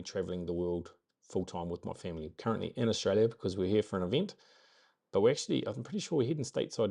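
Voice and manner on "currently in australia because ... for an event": ordinary talk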